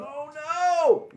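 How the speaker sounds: high, loud, drawn-out "oh no"; mock dismay. Player cornered by an enemy, mock dismay. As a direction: wail it in exaggerated alarm.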